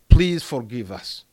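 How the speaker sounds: normal talking voice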